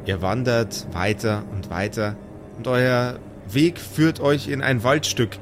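ordinary talk

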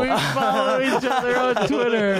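high, slow mocking echo. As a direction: parrot it back with scorn. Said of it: "a weird, that's a thing people say now".

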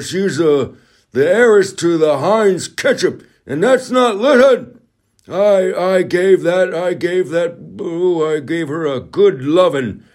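hammed-up high politician impression; John Kerry impression. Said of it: "This is my John Kerry impression".

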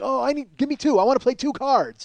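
imitating an excited director; excited Tarantino. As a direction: bounce like a fan begging for cards. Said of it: "Tarantino is so excited".

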